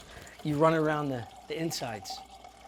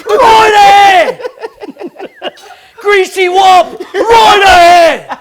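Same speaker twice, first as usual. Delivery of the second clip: high, full-force bellow with drawn-out endings; mock lookout shout; 20 dB louder, much slower than usual. Cook riffs on the sinking Titanic, mock lookout shout. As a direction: holler a warning across the deck in play.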